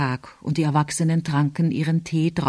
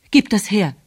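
firm, short order; curt demand; 3 semitones higher, 4 dB louder than usual. A narrator's reading. Uncle demanding the letter back, curt demand. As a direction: demand it with a touch of anger.

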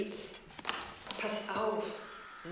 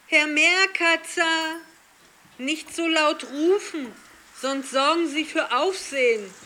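higher, loud call upward; 10 semitones higher, 9 dB louder than usual. Acted. Calling up to man on roof.

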